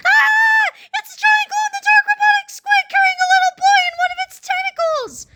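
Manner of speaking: high-pitched panicked scream. A narrator's reading. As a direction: scream it fast and very high in alarm. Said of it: "looked up from his phone and screamed".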